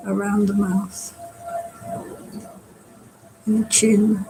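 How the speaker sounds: unhurried meditation guide with long pauses; guided meditation. Acted. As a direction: guide them gently through relaxing, phrase by phrase. Leads a body-scan meditation.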